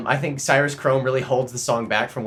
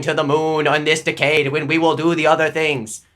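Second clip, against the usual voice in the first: loud, higher speechmaking JFK; JFK impression; 4 semitones higher, 5 dB louder than usual. Comic twisting the moon speech, loud JFK oratory. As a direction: declaim it with rising podium force. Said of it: "I can't do the J F K".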